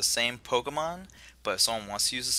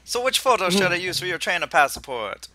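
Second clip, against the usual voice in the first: higher, louder Jamaican professor; attempted Jamaican accent; 6 semitones higher, 5 dB louder than usual. Host as professor asks about passport photo, Jamaican accent. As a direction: put on a bold Jamaican accent. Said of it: "I can't do a Jamaican accent".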